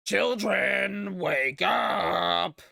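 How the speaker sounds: loud, drawn-out theatrical summons; villain's yell. Retold or imitated. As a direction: bellow it like a hammed-up villain. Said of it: "he yells".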